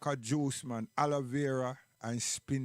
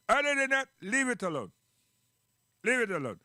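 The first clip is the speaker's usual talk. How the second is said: emphatic; 7 semitones higher, 4 dB louder than usual. Real, not acted. Emphatic warning.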